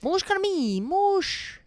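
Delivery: high, mock French accent; terrible French accent. Co-host doing a bad French accent for the girl. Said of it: "That was a terrible French accent".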